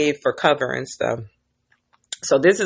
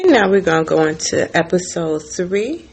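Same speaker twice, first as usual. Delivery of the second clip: ordinary talk, nothing marked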